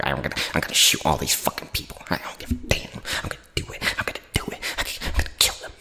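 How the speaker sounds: soft unvoiced nerdy whisper. Narrator imitating Kapoor's whispered threats, nerdy and soft. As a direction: whisper the threats like a creepy nerd. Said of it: "the nerdy voice".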